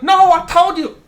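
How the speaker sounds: put-on high, loud snapping Vietnamese-accented lady; aggressive owner. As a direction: snap back impatiently in her accent. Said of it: "so aggressive".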